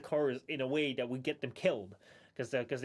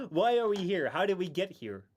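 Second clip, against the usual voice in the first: ordinary talk, nothing marked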